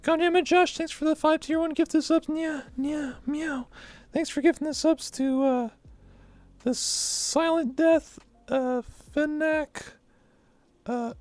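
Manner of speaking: put-on very high cutesy anime cat girl; squeaky kitten voice thanking fans. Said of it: "I had to do that voice".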